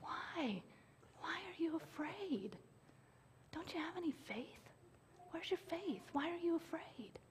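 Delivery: unvoiced, tender whisper; hushed, close rebuke. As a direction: gently ask the scared men. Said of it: "he goes".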